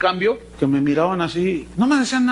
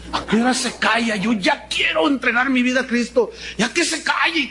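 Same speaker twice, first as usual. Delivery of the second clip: higher, exasperated inner voice building to a shout; fed-up mock shout; impatient; 5 semitones higher than usual. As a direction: plead for the preacher to stop talking. Impatient convert waiting for preacher.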